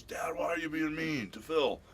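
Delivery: high, drawn-out imitation of daughter; complaining daughter. Daughter phones father about her boyfriend, high-pitched complaint.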